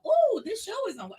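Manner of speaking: high, loud, drawn-out delight; light up loudly, stretching the words; delighted exclamation. Retold or imitated. Waiting friend spotting her show on TV, delighted.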